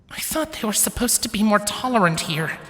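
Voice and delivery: high-pitched little character voice; heroine's uneasy thought. A narrator's reading. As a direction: think it aloud in a high small voice.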